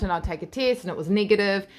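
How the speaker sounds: usual speaking voice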